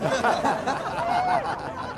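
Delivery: high, soft chuckle; real laugh after own punchline; amused laugh. Real, not acted. Veteran laughs at his own story, amused.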